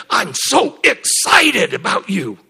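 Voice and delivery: high, loud, drawn-out delight; slow, stretched burst of excitement; excited. Retold or imitated. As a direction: voice God thrilled about someone. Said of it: "Have you ever heard the Spirit of God talk like that".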